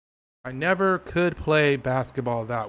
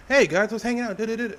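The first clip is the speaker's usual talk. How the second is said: higher, peppy intro greeting; upbeat streamer voice; 7 semitones higher than usual. Shown as a demonstration. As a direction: bounce it like a stream opener. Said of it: "I'm not doing my upbeater, my up, my cell voice".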